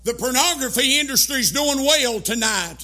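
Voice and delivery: loud, driving sermon cadence; fiery preaching. Acted. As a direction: preach it hard to the congregation. Preaching at full force.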